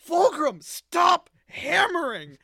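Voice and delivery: high, loud slow exasperated yelling; angry shout. Retold or imitated. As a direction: yell at him to stop hammering. Emperor yelling at son.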